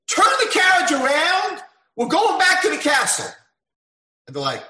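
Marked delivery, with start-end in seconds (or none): 0.1-3.3 loud, high shouted order; royal command. Acted. Young king ordering his soldiers to turn back, shouted. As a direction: bark the order to the driver.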